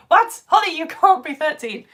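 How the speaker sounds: loud, high-pitched what; shocked, rising exclamation; disbelieving. Real, not acted. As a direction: burst out in astonishment. Mock disbelief at viewer's age.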